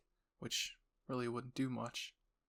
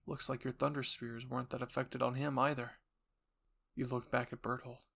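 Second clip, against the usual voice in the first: usual speaking voice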